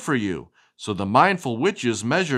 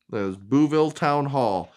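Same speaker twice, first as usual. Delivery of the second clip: usual speaking voice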